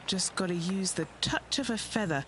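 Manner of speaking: ordinary talk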